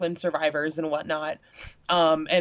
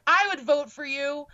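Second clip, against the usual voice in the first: lightly voiced little girl, pitched up; 9 semitones higher than usual. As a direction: quote a child in a higher voice. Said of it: "one of the little girls".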